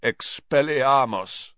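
slow, rasping croak. A narrator's reading. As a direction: croak the spell out hoarsely, slowly. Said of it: "he croaked".

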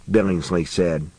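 usual speaking voice

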